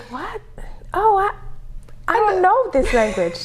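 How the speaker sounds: high, halting confusion; bewildered. Retold or imitated. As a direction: puzzle it out, starting almost under your breath. Retold bewildered private thought.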